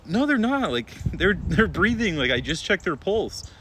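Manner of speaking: high, loud disbelieving denial; panicked high-pitched protest; frantic protest. Retold or imitated. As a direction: insist they're still breathing. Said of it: "I'm like".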